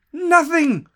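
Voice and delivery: high, loud, incredulous; emphatic exclamation. Real, not acted. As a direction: punch it out in disbelief. Emphatic repeat of nothing.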